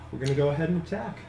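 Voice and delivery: normal talking voice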